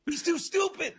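high, pushed exclaiming; mock the stupidity with glee; exasperated glee. Real, not acted. Excited rant about Ray.

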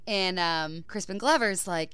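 normal talking voice

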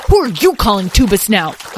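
put-on loud, offended child's comeback; indignant retort. A narrator's reading. Lyle answers his brother's name-calling, indignant retort. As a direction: throw the insult straight back. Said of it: "retorted Lyle".

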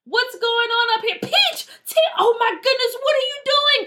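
high-pitched horrified discovery; walk in on a disaster and cry out; shocked alarm. Acted. Big sister walks in, horrified.

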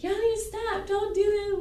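high-pitched plea; imitated pleading friend. Friend breaking up the yelling, high imitated plea.

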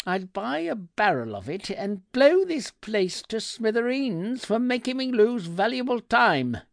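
high-pitched indignant tirade; cold fury. A narrator's reading. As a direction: rant at the useless shop assistant, pitch held up. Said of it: "my voice as cold as the devil's heart".

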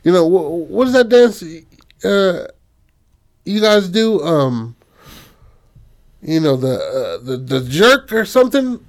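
high-pitched, hesitant put-on voice; mayor character. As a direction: fumble eagerly for the name of a dance. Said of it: "the mayor comes up to her".

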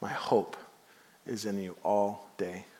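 a slow, humble prayer, dying away; quiet prayer. Acted.

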